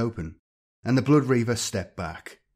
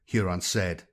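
usual speaking voice

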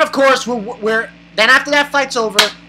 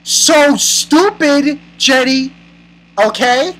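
slow, high, emphatic telling-off; exasperated scolding; 5 semitones higher, 4 dB louder, slower than usual. Real, not acted. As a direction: stretch it out, scolding her.

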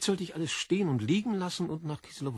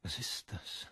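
whispered in terror, far softer; frightened whisper; 6 semitones lower, 11 dB quieter, slower than usual. Acted. Berlioz seeing the see-through man, a terrified whisper. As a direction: breathe it out, scared stiff.

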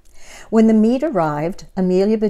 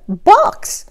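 high, loud exclaimed word; exclamation; 13 semitones higher, 7 dB louder than usual. Acted. Missus Rogers hearing about the box, high loud exclaim. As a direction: bark it out in alarm. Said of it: "exclaimed Missus Rogers".

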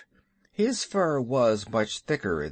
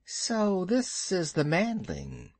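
high, slow, sinuous snake; python voice; 6 semitones higher than usual. Acted. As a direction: draw it out as a curious serpent. Python Kaa inspects the boy.

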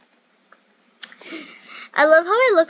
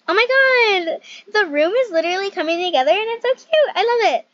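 higher and louder, gushing; real delight, bubbling over; excited squeal; 7 semitones higher, 7 dB louder than usual. Real, not acted. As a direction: burst out thrilled at the room. Room finally coming together.

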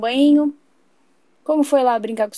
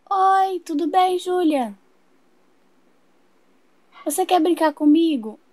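put-on high, slow little-girl greeting; 5 semitones higher than usual. Little sister greeting her brother's friend, in a high, slow child voice. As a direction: sing it out like a small girl.

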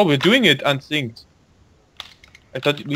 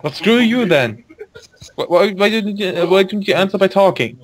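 mock outrage; 7 semitones higher than usual.

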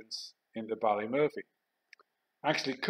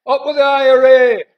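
much higher, very loud rallying yell; full-volume shout; 14 semitones higher, 18 dB louder than usual. Retold or imitated. Soldier provoking the rioting crowd, full-volume shout. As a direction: yell it out as loud as you can. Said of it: "as loud as I could".

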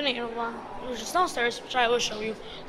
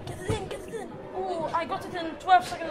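higher, eager cheering; excited; 6 semitones higher, faster than usual. Real, not acted. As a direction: urge the ball in, then celebrate. Cheering on own game attempt.

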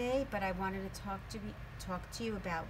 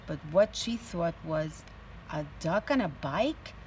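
lively, louder storybook voice; teacher reading a picture book aloud to her class; reading to children; 9 dB louder than usual. A narrator's reading. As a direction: read it out with energy for little kids.

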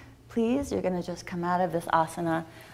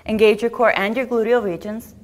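"Engage your core and your gluteal regions" rushed through, a bit louder; too fast; 3 semitones higher, 7 dB louder than usual. Shown as a demonstration. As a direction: hurry the instruction a little too quickly. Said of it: "That was too fast".

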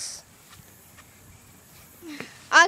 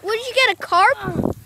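surprised; 7 semitones higher than usual. Real, not acted. Big fish surprise.

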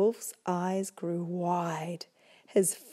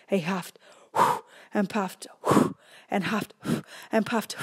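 huffing and puffing; 5 dB louder than usual. Acted. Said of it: "And his huffing began".

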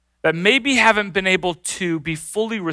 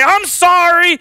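high-pitched mock sibling apology; forced apology; 11 semitones higher, 4 dB louder, slower than usual. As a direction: toss out an insincere kid's sorry.

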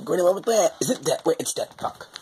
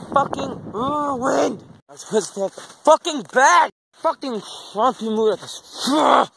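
frustrated; 9 semitones higher, 3 dB louder, slower than usual. Real, not acted. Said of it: "I'm really frustrated about everything".

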